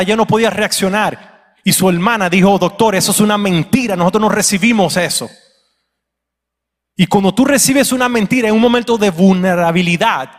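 loud, high-pitched preaching; full-force preaching. Real, not acted. Preacher telling the church of a pastor's testimony, full-force preaching. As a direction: build the testimony into a loud, urgent exhortation.

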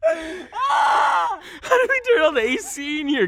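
high, loud, drawn-out holler; stretched shout. Pretend. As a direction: belt it out slowly into the mic. Calling out into the mic.

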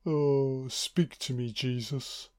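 soft, slow whimpered plea; mock whining. Woman begging Jesus for help, quiet mock whine. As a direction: imitate a feeble whine, fading away softly. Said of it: "Oh, she's just whining".

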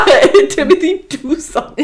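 high, loud laughing call to Timothy; laughing plea. Acted. Friend jokingly pleads with imaginary listener Timothy, laughing high and loud. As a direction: laugh through a mock plea.

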